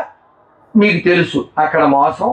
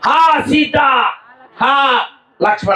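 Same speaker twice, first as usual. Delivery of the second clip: high, calling out like Rama in distress; a demon faking a desperate call; lightly mimicked cry of Rama higher up; mimicked cry for help; 6 semitones higher, slower than usual. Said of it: "ఏమని mimicry చేశాడు?".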